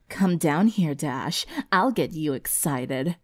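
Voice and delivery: low, soft, slow tempting voice; suggestive taunt. Acted. Chained Sunset luring Dash downstairs, suggestive.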